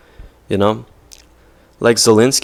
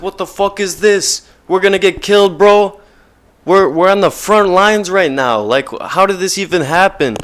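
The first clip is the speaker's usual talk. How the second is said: put-on high, loud, frantic young woman; panicked girl's voice; 9 semitones higher, 5 dB louder than usual. Girl in a front-line bunker protests to soldiers, high panicked cry. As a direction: squeal it out like a terrified teen.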